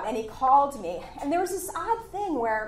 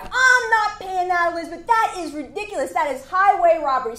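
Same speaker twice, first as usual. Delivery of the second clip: indignant client; 8 semitones higher, 8 dB louder than usual. Retold or imitated. Headhunter voicing the outraged hiring client, high and loud. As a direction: act out the client scoffing at the price. Client balking at salary demand.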